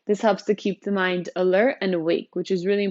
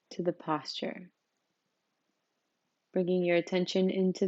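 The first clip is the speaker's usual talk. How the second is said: softer, unhurried voice, settling listeners into the body; guided meditation; 4 dB quieter than usual. Real, not acted. Teacher leading newcomers through a seated practice, calm meditation guidance.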